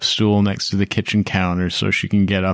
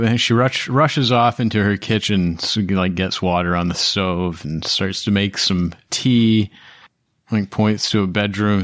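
usual speaking voice

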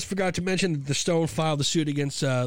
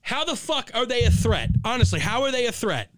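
how dare they; incredulous anger; 8 semitones higher than usual. Real, not acted. Host furious at the lawsuit, incredulous.

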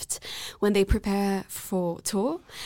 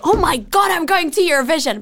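excited exclamation; 6 semitones higher, 7 dB louder than usual. Retold or imitated. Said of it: "I was like".

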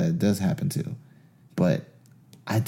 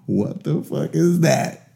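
higher, slow, drawn-out alarmed question; retelling fright at a roar in the dark; spooked and slow; 6 semitones higher, slower than usual. Retold or imitated. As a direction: stretch it out like you just heard a roar. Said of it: "I was just like".

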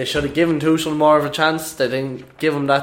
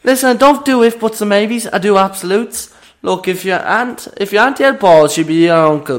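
higher, louder, cocky comic rant; sketch character voice; 8 semitones higher, 7 dB louder than usual. Acted. Co-host ranting in the sketch character's voice. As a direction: lay down the law like a cocky pundit. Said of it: "And that was Conor Moore Sketches".

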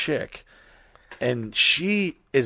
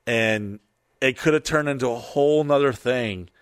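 ordinary talk

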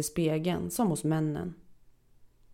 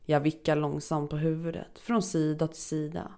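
ordinary delivery

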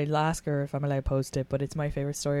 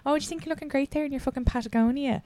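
higher, mocking jab about the jacket; mean teasing; 6 semitones higher than usual.

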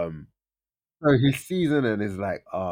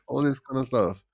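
plain reading voice

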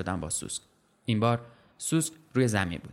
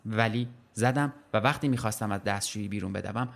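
ordinary delivery, nothing marked

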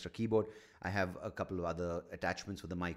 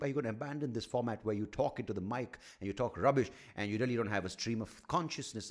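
ordinary talk

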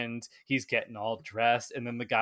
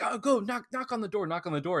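eager Scrooge; 7 semitones higher than usual. Retold or imitated. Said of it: "Scrooge is like".

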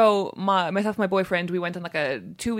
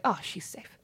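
softer musing aside; soft quoted thought; 8 dB quieter, slower than usual. Retold or imitated. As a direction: say it softly as others would think it. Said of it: "that's just like".

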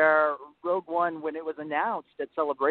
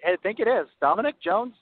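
coy; 6 semitones higher, 3 dB louder than usual. Real, not acted. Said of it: "You sound so coy".

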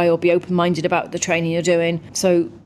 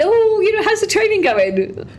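much higher, louder, gushing mock voice; gushing fan; 3 dB louder than usual. Fan at petrol station asking about training, gushing and high. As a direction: play the overexcited stranger, slowing on the question.

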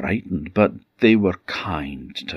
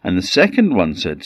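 ordinary delivery, nothing marked

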